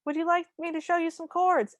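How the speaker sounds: high, sustained mock yell; calling over noise, pitched high; mock screamy. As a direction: offer it as a pretend shout. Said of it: "having a screamy conversation".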